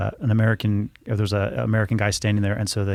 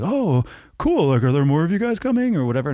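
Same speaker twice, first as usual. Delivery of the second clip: feigned casualness; 7 semitones higher than usual. Retold or imitated. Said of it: "he catches himself".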